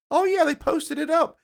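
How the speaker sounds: high, slow quoted friend; light high-pitched friend voice; quoting a friend. Retold or imitated. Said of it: "Jess was like".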